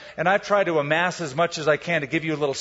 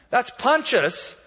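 put-on kid's answer; 8 semitones higher, 3 dB louder than usual. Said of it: "The kid said, like".

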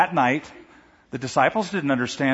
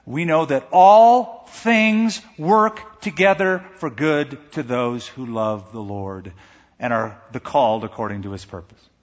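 solemn scripture quote; 2 semitones lower than usual. Acted. Quoting Romans 8:28 slowly.

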